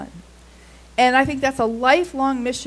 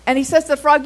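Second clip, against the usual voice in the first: ordinary delivery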